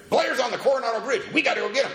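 high and loud, pressing news; urgent alarm. Retold or imitated. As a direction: sound the alarm, no time to lose. Sponsor's emergency news at night.

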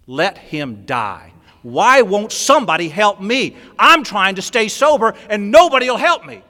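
slow, loud, high self-pitying cry; loud whining protest down the phone; desperate wail. Retold or imitated. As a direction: plead that nobody helps you, voice rising to a wail. Refusing the late-night call, desperate.

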